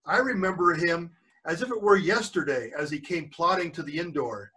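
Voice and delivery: usual speaking voice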